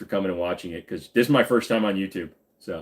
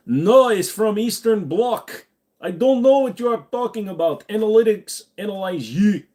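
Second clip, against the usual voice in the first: put-on Russian accent; 12 semitones higher, 5 dB louder than usual. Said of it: "Scottish in the middle of my Russian?".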